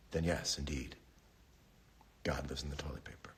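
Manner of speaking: hushed, secretive mock revelation; mock whisper. Host whispers the toilet-paper God revelation, hushed.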